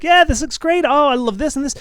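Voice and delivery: very high, bright gush of delight; excited. Shown as a demonstration. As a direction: squeal with joy over the artwork. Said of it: "if it's a tone-wise, you're like".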